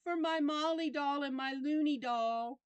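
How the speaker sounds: put-on high, sweet thank you of a four-year-old girl; little girl piping thank you up at the camera; cute little girl's voice. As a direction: imitate a tiny girl thanking someone sweetly. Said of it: "cutest little voice I've ever heard in my life".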